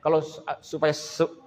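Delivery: ordinary talk, nothing marked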